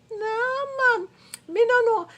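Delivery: high, incredulous "nah, man"; fed-up cry of disbelief; exasperated outburst. Real, not acted. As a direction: throw up your hands in disbelief. Exasperated outburst about church scammers.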